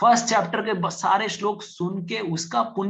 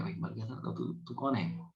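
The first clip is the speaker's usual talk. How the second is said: scared; 4 semitones lower, 10 dB quieter than usual. Retold or imitated. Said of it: "आदमी डर गया".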